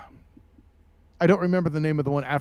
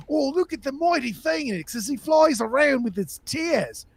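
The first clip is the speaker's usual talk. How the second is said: put-on Australian accent; 7 semitones higher than usual. Mocking wildlife-host tone again.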